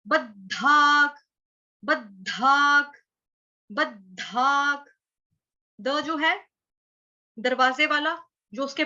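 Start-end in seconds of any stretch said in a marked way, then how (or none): 0.1-4.9 बद्धाक said slowly, half द joined to full ध; slow pronunciation demo. Shown as a demonstration. Teacher slowly demonstrating बद्धाक for students. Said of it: "ब जो है वो बकरी वाला है".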